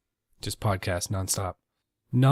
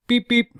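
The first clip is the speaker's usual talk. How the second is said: high loud beep beep; mock horn blasts; horn imitation; 9 dB louder than usual. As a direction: honk it like a car horn.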